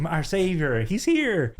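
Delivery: hammed-up relief at a savior's arrival; mock adoration. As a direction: hail your rescuer with mock awe. Mock worship of repairman.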